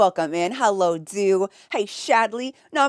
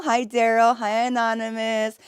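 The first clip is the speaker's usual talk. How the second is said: normal talking voice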